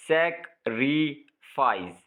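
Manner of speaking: "Sacrifice" slowly in three separate beats; syllable by syllable. Shown as a demonstration. Teacher counting syllables, "sacrifice" broken into three slow parts. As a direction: pause between सैक, रि and fries in "sacrifice". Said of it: "तीन syllable".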